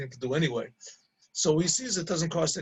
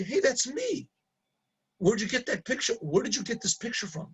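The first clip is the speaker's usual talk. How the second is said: higher-pitched startled recognition; retold surprise; 6 semitones higher than usual. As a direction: replay being taken aback, voice raised in pitch. Retold surprise at seeing himself.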